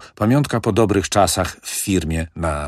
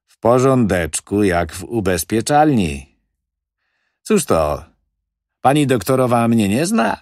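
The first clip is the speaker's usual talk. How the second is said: louder, higher, breezy wheeler-dealer; smooth-talking manager; 5 semitones higher, 4 dB louder than usual. Acted. Manager brushing off the old widow's fears, breezy and loud. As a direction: brush off her worries with swagger.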